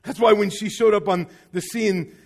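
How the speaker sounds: ordinary talk, nothing marked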